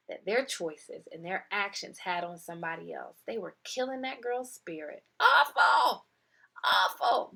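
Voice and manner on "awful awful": pained. Real, not acted. Reacting to the girl's pain.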